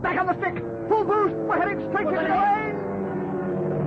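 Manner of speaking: frantic pilot shouting; alarmed shouting. Acted. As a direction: yell orders as the plane dives. Pilot calls out in crash.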